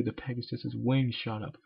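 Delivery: usual speaking voice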